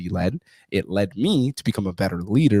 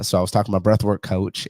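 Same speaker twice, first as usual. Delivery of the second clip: normal talking voice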